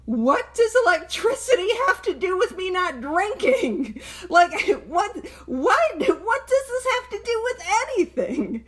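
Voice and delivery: sputtering confused disbelief, half laughing; exasperated retold self. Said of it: "I'm like".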